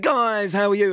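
high-pitched, over-eager hello; mock gushing greeting. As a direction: imitate someone greeting stars far too keenly. Mimicking friend's gushing greeting to celebrities.

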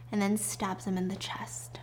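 unvoiced hushed reveal; dramatic whisper. Shown as a demonstration. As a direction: whisper the twist for effect. Dramatic twist told in whisper.

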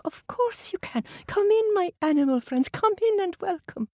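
put-on high, welcoming old lady; sweet old lady. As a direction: invite them in kindly.

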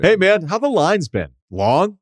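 hammed-up friendly bar chatter; mock bouncer small talk. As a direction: play the eager regular buttering up the bouncer.

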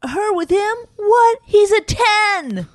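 high, loud, shrieking disbelief; mocking disbelief. Wife mimics husband's shocked reaction, high squealing disbelief. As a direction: squeal it like it's outrageous. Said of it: "You were like".

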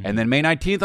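ordinary talk, nothing marked

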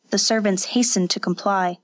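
ordinary delivery

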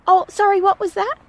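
lightly voiced curious neighbour; neighbour turning back. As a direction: chirp it like a nosy neighbour. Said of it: "she sort of turned around and said".

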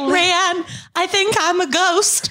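high-pitched comic wail, half laughing; mock crying. Retelling crying to a friend in the street, high mock-tearful voice. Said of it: "Crying in the street, being like".

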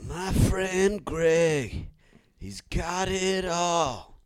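slow, solemn mock eulogy; mock tribute. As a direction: drag it out like a tribute speech.

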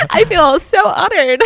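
high, loud, laughing through the words. Real, not acted. American host joking she is honored, laughing. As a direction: joke while still cracking up.

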